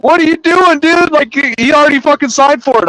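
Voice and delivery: high-pitched retold disbelief; agitated mock outcry, high and insistent; exasperated protest. Second clerk protests during scuffle.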